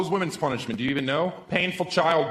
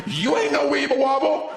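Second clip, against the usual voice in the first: taunting; 11 semitones higher, 4 dB louder than usual. Retold or imitated. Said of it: "I will push you over and be like".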